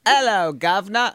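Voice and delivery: Cockney demo greeting; London accent. Shown as a demonstration. Host demonstrates for his co-host, London accent. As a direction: model a broad London accent. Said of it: "get into my London accent".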